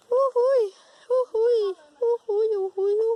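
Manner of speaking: high, loud whoops; excited whooping. Real, not acted. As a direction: whoop as you run. Kid whoops while running from bees.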